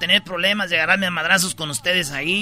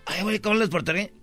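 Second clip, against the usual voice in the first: usual speaking voice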